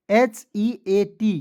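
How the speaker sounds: very slow letter by letter spelling. Shown as a demonstration. Teacher spelling out heat to students, very slow. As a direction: drag each letter for the class. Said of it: "teacher spells slowly for class".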